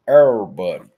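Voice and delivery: hammed-up country pronunciation; country accent. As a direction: stretch it into a country "errr-body". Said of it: "It's like he's from the country".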